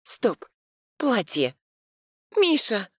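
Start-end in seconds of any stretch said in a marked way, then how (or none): 2.4-2.9 sudden alarmed call, much higher; startled cry of his name; surprised. A narrator's reading. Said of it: "округляю глаза я".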